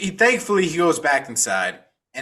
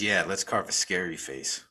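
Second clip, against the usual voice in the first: lower, softer, a lightly voiced dad through held-back anger; suppressed rage; 7 semitones lower, 8 dB quieter than usual. A narrator's reading. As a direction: say it low, holding back hate. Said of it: "shaking in just straight anger".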